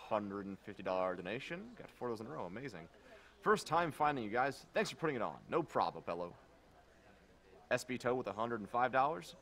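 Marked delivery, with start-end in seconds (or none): none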